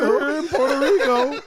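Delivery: can barely get it out for laughing. Real, not acted. Telling the cruise story to John, cracking up.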